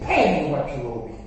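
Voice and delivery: a hint of a harsh creditor; demanding servant. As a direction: demand the debt, gripping. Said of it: "He said".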